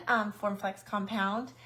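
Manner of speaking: usual speaking voice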